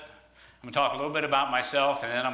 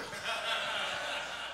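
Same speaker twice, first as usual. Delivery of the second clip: breathy laugh; 8 semitones higher, 10 dB quieter than usual. Real, not acted. Laughs at own foolish ladder trick.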